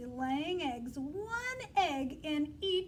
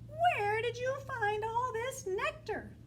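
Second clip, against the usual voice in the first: higher, slow, curious bee voices; 8 semitones higher, 4 dB louder, slower than usual. Acted. Teacher voicing the other bees, higher and slow. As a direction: ask wide-eyed like wondering bees. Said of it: "the other bees asked".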